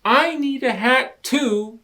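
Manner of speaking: high, loud little rabbit wanting a hat; Rabbit's voice. Acted. Jealous Rabbit tells Lion he needs a hat. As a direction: pipe up high and insistent.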